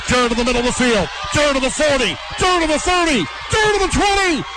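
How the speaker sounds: rising excited play-by-play shout; building to a big touchdown shout; touchdown call. Real, not acted. Radio play-by-play long run.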